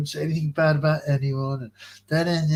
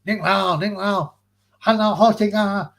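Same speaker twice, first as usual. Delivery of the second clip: higher-pitched Mandarin phrases; showing off Mandarin; 6 semitones higher, 4 dB louder, slower than usual. Shown as a demonstration. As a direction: try out a bit of Mandarin. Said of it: "I speak a bit of a Mandarin Chinese".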